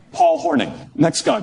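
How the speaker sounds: normal talking voice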